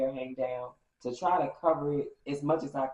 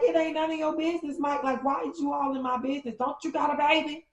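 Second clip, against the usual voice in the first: yelling back; 10 semitones higher, 6 dB louder than usual. Retold or imitated. She snaps back at ex.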